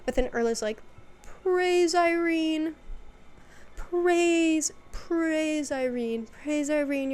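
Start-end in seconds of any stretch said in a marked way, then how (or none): 1.4-7.1 high, slow, loud zealot chant; crazed cult praise. Retold or imitated. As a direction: hail the goddess like a fanatic. Erla worshipping, crazy cat lady.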